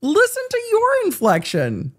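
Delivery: mocking exclamation. Real, not acted. Commentator scolds lawyer over his inflection, mocking. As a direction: call him out in a high, exasperated pitch.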